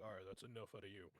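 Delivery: clipped back into his calm persona; mask back on. Acted. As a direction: shut the dark side down briskly. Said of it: "putting the mask back on".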